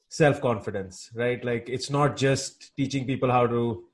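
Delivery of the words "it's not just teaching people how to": ordinary talk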